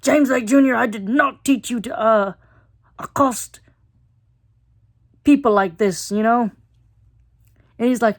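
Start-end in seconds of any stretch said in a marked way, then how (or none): 0.0-6.5 dad voice. Retold or imitated. Blinky scolding Jim as his dad, in a stern father voice. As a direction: scold him like a disappointed dad. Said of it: "Blinky brings out the dad voice".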